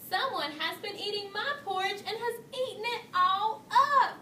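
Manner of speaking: a little bear's wail that peaks; crying out. A narrator's reading. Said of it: "cried Baby Bear".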